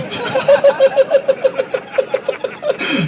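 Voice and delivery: high, long giggling laugh; stoned giggle fit. Retold or imitated. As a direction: cackle like you can't stop. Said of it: "I'm laughing at my hand".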